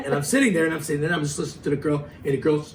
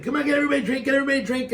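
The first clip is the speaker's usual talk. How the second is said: pushy bartender; 10 semitones higher, much faster than usual. Retold or imitated. Pushy bartender urging drinks.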